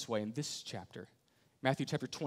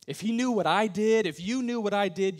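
much higher and louder, anguished; loud desperate plea; 7 semitones higher, 9 dB louder than usual. Pretend. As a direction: blurt out the shame. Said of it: "you say it this way".

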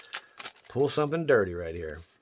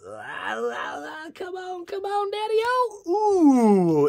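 high squealing plea, much higher, drawn out on Daddy-o; excited pleading; 7 dB louder, much slower than usual. Real, not acted. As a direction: beg the pack for a hit, voice jumping up. Begging for a good card.

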